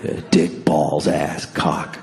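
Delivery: a lightly voiced creepy old man reciting; hushed old man. Old man listing bad words.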